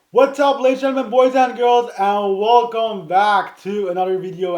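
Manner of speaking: loud, fast, high hype greeting; hyped intro. Real, not acted. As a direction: blast the channel intro. YouTuber video opening greeting.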